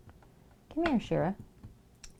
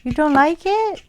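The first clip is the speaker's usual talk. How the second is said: excited pet talk; 10 semitones higher, 11 dB louder, slower than usual. Real, not acted. Owner squealing as her cat hisses at the toy fish. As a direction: squeal at the animals with delight.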